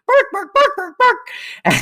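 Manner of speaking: very high yappy barks; squeaky mock dog yaps; imitated dog barking. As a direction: bark rapidly like a little dog. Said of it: "And then you hear, like".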